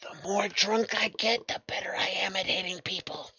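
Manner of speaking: conspiratorial whisper, very soft. Acted. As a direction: lean in and whisper the secret. NPC leans in whispering.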